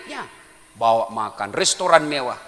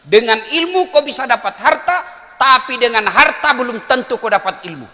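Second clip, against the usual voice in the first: loud preacher's restatement, higher; emphatic louder repeat; 9 semitones higher, 11 dB louder than usual. Shown as a demonstration. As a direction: raise the voice and press the maxim. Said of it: "Saya ulang".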